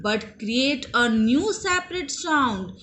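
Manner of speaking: slow, word-by-word English sentence; slow dictation. Shown as a demonstration. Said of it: "teacher dictates".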